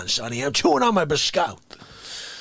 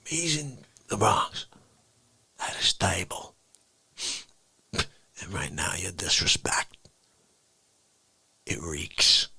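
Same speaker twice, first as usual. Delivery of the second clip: low, hushed mob threat; menacing low whisper; 6 dB quieter than usual. Acted.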